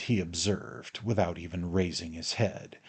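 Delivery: plain reading voice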